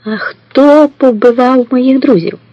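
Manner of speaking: high, slow, sugary question; sweet voice. A narrator's reading. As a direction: ask it sweetly, drawn out and high. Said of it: "запитала Мулуда солодким голосом". (солодким високим голосом)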